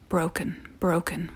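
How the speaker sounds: hushed whining whisper; a soft, whimpering whine. Acted. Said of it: "whines the fat man".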